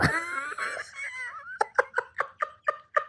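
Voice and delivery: very high giddy laugh; high squealing laugh. Real, not acted. Big laugh at own joke.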